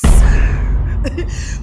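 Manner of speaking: big real laugh bursting out; loud burst of laughter. Real, not acted. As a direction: break into loud laughter at your own joke. Laughs at own Kansas joke.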